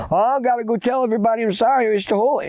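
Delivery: put-on high, rushed boy's voice; acted frightened babbling; panicked boy. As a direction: rush it out high and worried. Scared boy explains to friend.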